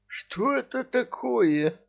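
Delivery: very high, slow strained wheeze; wheezy rasp. Acted. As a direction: gasp it out after the burning drink. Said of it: "просипел он".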